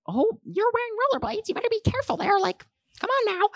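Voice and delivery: high-pitched motherly caution; mock mom voice. Mom warns the boy in rollerblades, high mock voice. Mom's warning, retold in high voice.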